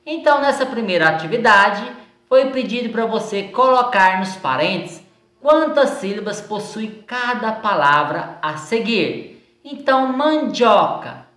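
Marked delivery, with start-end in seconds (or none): none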